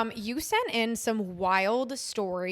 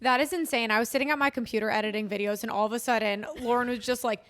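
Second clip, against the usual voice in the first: normal talking voice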